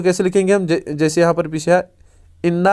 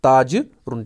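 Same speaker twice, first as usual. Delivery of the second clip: slow model answer; slower than usual. Shown as a demonstration. Teacher showing students the answer, slow and careful. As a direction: slowly spell out इन्नहु ताजरुन word by word. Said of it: "अब इसको हम कैसे लिखेंगे?".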